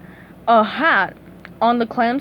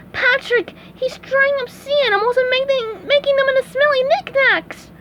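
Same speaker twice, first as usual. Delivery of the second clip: very high cartoon panic; high, urgent squeal; alarmed SpongeBob; 13 semitones higher than usual. A narrator's reading. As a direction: warn a friend in horror. Horrified hero warns his friend.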